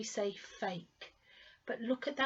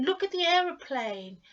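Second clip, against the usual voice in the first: raised, louder formal pointing out; 7 semitones higher, 6 dB louder than usual. Retold or imitated. A formal person at the airport pointing out a plane, higher and louder. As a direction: call it out a bit formally, higher. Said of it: "English people going".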